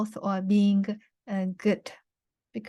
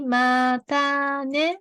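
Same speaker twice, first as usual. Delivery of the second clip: slow, drawn-out Mata ne with rising ne; careful teacher demo; slow model phrase; 5 semitones higher, much slower than usual. Shown as a demonstration. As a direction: say Mata ne slowly for learners to copy. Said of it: "Repeat after me".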